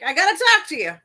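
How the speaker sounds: hammed-up movie dame; Ma Kelly. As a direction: press for a talk, in character. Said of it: "He's like".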